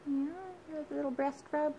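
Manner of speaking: sing-song pet talk. Real, not acted. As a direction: coo at the pet birds. Talking to pet birds.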